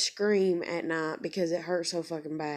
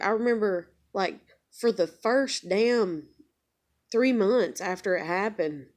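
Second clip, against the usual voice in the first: ordinary talk, nothing marked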